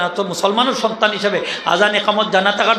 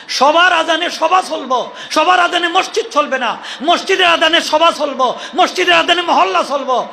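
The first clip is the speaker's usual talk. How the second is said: raised, ringing sermon voice with shouted lines; fiery preaching; 5 semitones higher than usual. Acted. Preacher hammers the mosque azan rule at the crowd, shouting. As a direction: pound the rule into the congregation.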